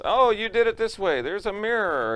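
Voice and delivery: high, loud know-it-all heckler. Retold or imitated. Audience member yelling out the secret, high smug call.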